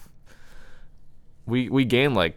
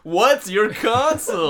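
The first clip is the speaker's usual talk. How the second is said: much higher, louder, slow overdone ask; exaggerated question; 12 semitones higher, 6 dB louder, slower than usual. Shown as a demonstration. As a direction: ham up the question. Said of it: "I can't say it normally now".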